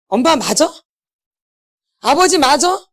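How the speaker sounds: 조금 높아진 목소리로 부모에게 따지듯; 엄마 아빠에게 서운함을 쏟아내듯; 부모를 향한 원망. Pretend. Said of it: "엄마, 아버지 막 얘기해". (어린 시절 상처로 부모에게 따지는 원망 어린 목소리)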